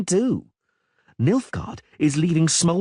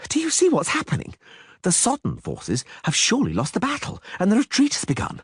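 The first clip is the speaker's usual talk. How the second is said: panicked poet; 9 semitones higher than usual. A narrator's reading. Panicked poet urging flight.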